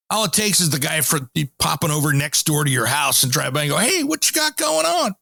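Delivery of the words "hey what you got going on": nosy inspector. Retold or imitated. Imitates nosy building inspector.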